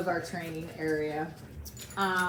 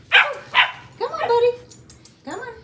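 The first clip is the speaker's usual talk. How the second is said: much higher, loud excited call; calling the dog; 13 dB louder, slower than usual. Real, not acted. Trainer urging the puppy up off the mat, loud high call. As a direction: call him off the mat with big energy.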